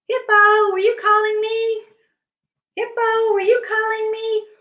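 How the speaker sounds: high-pitched singsong call. Acted. Bear answers Hippo's cries, high calling voice. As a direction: call high to a friend out of sight.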